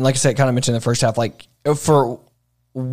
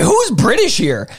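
high, drawn-out incredulous question; retold bafflement; 11 semitones higher, 4 dB louder, slower than usual. Moviegoer retelling his confusion at the film, baffled. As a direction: blurt it out in disbelief, much higher.

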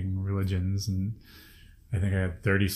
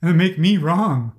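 slow, higher and louder with resentment; bitter indignation; 8 semitones higher, 7 dB louder, slower than usual. Real, not acted. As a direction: drag it out, stung and angry. Venting anger about bystanders.

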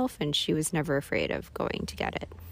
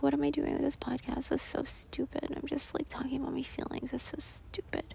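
self-mocking whisper; 7 semitones higher, 8 dB quieter than usual. Inner critic voice, whispered.

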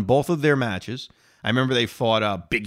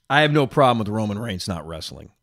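ordinary delivery

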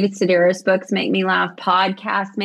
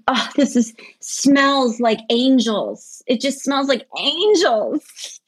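slow, swooning delight, rising higher; retold rapture; 4 semitones higher, slower than usual. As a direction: gush as if intoxicated by the scent. Retelling rapture over a perfume.

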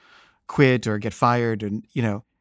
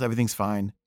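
usual speaking voice

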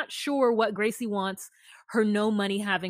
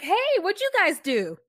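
imitating cheery character; 9 semitones higher, 6 dB louder than usual. Quoting character chirpily.

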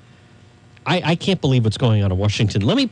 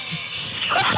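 breathy laugh; 6 dB quieter than usual. Real, not acted. Laughs again after clip.

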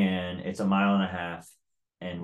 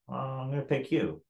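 higher-pitched, lightly taunting retold line; breezy challenge, pitched up; mock casual; 7 semitones higher than usual. Retold taunt to his chief.

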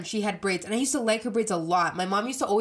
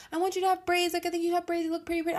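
much higher, sweet coaxing mom voice; 10 semitones higher, much faster than usual. Retold or imitated. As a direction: sweetly coax your daughter into braids. My mom would always be like.

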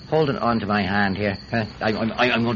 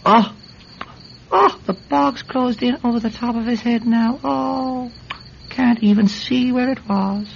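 high, wailing dismay; distressed; 11 semitones higher than usual. Acted. Man watching his friend vanish into the bog, distressed. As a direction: lament it as it happens. Watching head sink from sight.

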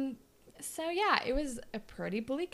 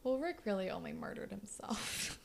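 softer, dark-humoured whisper; 7 dB quieter than usual. Real, not acted. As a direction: whisper the grim joke.

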